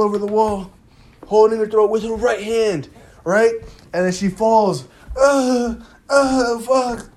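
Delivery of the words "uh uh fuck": imitated dying gasps. Acting out Kaz falling dying.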